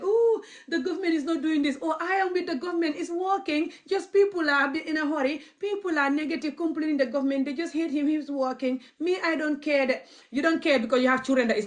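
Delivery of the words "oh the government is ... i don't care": higher, lightly mocking voice of a complacent elder. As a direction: mimic a smug old gossip praising the government. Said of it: "they're gonna sit there and talk".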